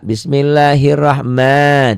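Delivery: Bismillahirrahman recited slowly, "ma" held long. Shown as a demonstration. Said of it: "Panjang bukan A-nya tapi ma ya".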